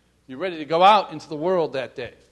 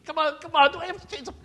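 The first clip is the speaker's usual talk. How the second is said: mock whine; 10 semitones higher, much faster than usual. Whining about changing pants.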